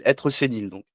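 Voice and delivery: ordinary talk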